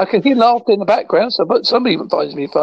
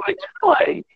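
high mock greeting; 9 semitones higher than usual. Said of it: "Anyone say".

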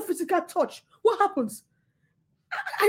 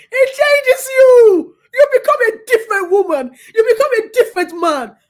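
much higher and louder, crying out the words; tearful joyful shout; 7 semitones higher, 8 dB louder than usual. Real, not acted. Preacher to congregation, crying out in joy. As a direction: shout it as joy cracks into tears.

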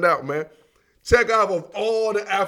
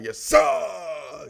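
sudden explosive shouted imitation from the gut; talking from the stomach; 3 semitones higher than usual. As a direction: bark it out from the belly, drawing out "sir". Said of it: "they talk with their, with their stomach".

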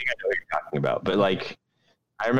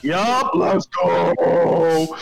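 excited; 8 semitones higher, 5 dB louder, much slower than usual. Real, not acted. Excited hype to start.

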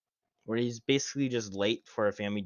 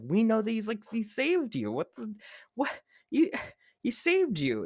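high outraged squeal; squealing in disbelief; exasperated; 11 semitones higher, 4 dB louder than usual. Retold or imitated. Mocking outraged reaction.

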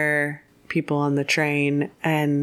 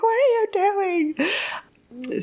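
very high-pitched, drawn-out mock dismay; hammed-up high-voiced outcry; alarmed protest. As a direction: squeal it slowly as a betrayed man. Retold man's alarmed reaction.

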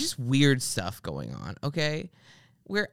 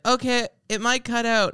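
phone woman; 9 semitones higher, 3 dB louder than usual. Retold or imitated. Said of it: "she's like".

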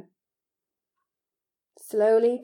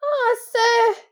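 high, loud, emotional gasp; gasping elf; 10 dB louder than usual. A narrator's reading. Tearful house-elf marvels at Harry, gasping. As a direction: overcome with admiration, pitched right up. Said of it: "he gasped".

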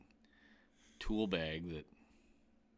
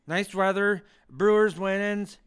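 excited; 9 semitones higher, 8 dB louder, slower than usual. Real, not acted. Daydreaming about summer.

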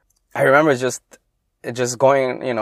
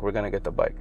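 much softer, fading reassurance; quieter repeat; 5 semitones lower, 10 dB quieter, much faster than usual. Retold or imitated. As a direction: say it again under your breath to calm him. Dad reassures, repeats line.

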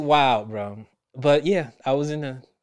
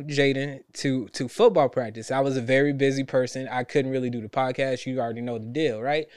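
ordinary talk

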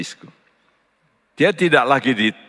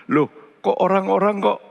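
astonished soul; 9 semitones higher than usual. Pretend. Voicing the soul of a dead person.

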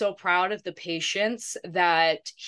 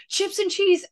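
high, loud eager interjection; Maddie; 12 semitones higher, 5 dB louder than usual. Retold or imitated. Friend imitating Maddie at the drive-thru, high eager order. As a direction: pipe up with a last-second order. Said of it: "Maddie goes".

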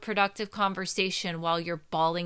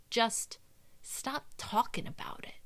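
ordinary delivery

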